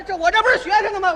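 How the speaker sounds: hurried excuse. Acted. Passenger hurriedly explains himself to the conductor. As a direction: rush out the excuse.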